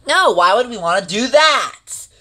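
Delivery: ordinary delivery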